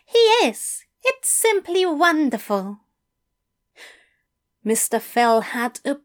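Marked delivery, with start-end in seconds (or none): 0.1-2.8 high, eager English teacher; bright, keen invitation from a fussy gentleman. A narrator's reading. Fell delighted.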